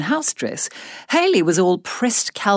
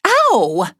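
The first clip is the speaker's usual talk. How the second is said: sharp yelp of pain; sudden pained ow; 6 semitones higher, 5 dB louder than usual. Acted. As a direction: cry out at a cut. Cuts her finger.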